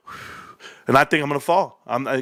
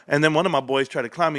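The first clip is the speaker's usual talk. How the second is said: normal talking voice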